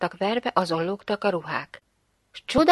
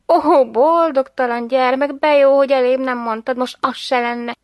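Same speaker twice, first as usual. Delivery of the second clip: loud, raised exclamation of dismay; mock dismay; 5 semitones higher, 7 dB louder than usual. A narrator's reading. Mother to son who knew of the coins, exclaiming in dismay.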